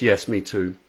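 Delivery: normal talking voice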